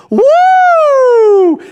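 long, high, loud "woo" whoop; wrestler's woo. Retold or imitated. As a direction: imitate a wrestler's sustained whoop. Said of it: "He goes".

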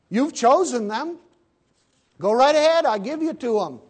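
high, loud, scornful go-ahead; mock dismissal. God to Israel, a scornful, loud dismissal. Preacher voicing God giving them up.

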